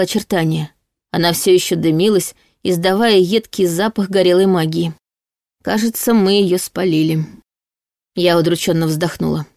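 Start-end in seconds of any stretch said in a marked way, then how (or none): none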